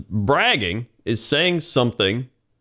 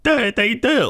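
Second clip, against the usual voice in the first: much higher, mock-amazed voice; gullible believer; 14 semitones higher than usual. People falling for fake videos, mock amazement. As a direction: imitate a credulous viewer in a high pitch. Mimics gullible commenters online.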